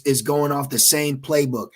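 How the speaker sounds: ordinary talk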